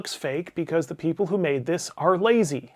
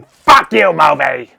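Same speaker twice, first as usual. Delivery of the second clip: loud angry yell at the screen; shouting; 14 dB louder, slower than usual. Retold or imitated. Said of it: "I spent the whole movie just shouting".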